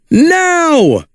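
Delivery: sharp signal call. Acted. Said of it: "called Woody".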